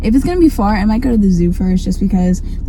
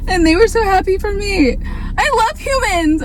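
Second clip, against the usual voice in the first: high, teary laugh of joy; laughing near tears; 10 semitones higher than usual. Real, not acted. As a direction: laugh through happy tears.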